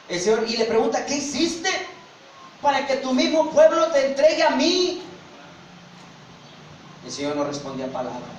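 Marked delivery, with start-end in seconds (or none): 1.1-5.0 bewildered questioning. Acted. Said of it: "Pilato se queda pasmado".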